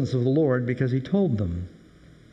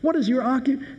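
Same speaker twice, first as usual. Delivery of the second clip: frantic sailor; 10 semitones higher than usual. Acted. Frantic sailors question Jonah.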